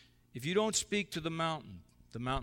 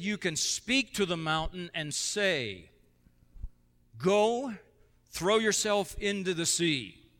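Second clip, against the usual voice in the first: forceful command; 5 semitones higher, 6 dB louder, slower than usual. Acted. Preacher quoting Jesus with force.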